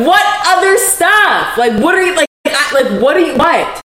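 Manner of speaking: high-pitched, exasperated retort; incredulous outburst. Retold or imitated. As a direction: demand an answer in high-pitched disbelief. Retelling her alarmed reply.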